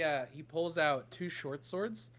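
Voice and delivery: usual speaking voice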